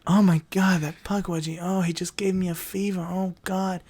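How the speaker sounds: breathy, whispered lovesick sighs; swooning woman. Retold or imitated. Voicing a smitten victim, dreamy and hushed. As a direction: swoon over the creature in a whisper. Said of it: "Another woman".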